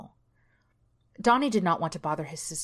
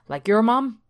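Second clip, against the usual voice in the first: laughing; 5 semitones higher, 7 dB louder than usual. A narrator's reading. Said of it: "Carl laughed loudly".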